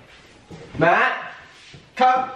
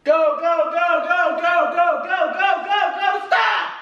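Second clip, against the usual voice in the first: building from steady calls to a shouted stop; escalating go go go to a yelled stop; rising shout of go; 4 semitones higher than usual. Real, not acted. Counting down the pour, calling out.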